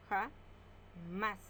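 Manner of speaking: Ja-más drawn out into two slow beats; syllable by syllable. Shown as a demonstration. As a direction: split Ja-más slowly. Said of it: "Separamos en sílaba". (Ja-más separada en sílabas, lento)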